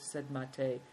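ordinary delivery, nothing marked

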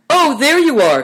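loud, high-pitched cry of greeting. A narrator's reading. Uncle Chen as the boys reach the yurt, crying out. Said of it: "cried Uncle Chen".

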